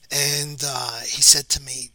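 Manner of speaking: normal talking voice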